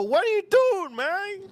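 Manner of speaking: high squeaky disbelief; shocked yelp. Retold or imitated. Telling parents at sushi dinner, high shocked squeal. Retelling his own shocked reaction.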